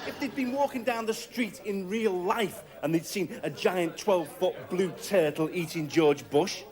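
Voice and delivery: ordinary delivery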